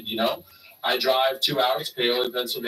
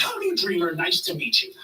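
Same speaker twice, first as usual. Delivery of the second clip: Paul imitation; 6 semitones higher than usual. Paul's voice in retold story.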